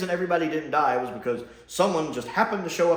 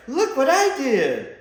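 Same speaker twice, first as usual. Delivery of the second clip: mock Chibnall, higher, louder and slow; smug boast; 11 semitones higher, 6 dB louder, slower than usual. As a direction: slowly show off your own achievement. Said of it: "and be like".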